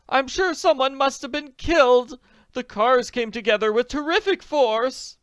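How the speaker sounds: high, loud shocked voice; alarmed lady. Acted. Lady after the crash, shocked high voice. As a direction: blurt the horror out.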